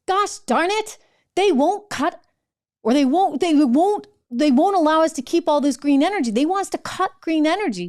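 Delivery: high, whiny mock complaint; mock indignation. Mocking Yellen's complaint about Republicans.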